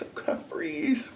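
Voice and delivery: high breathy whisper. Real, not acted. Cold early morning aside.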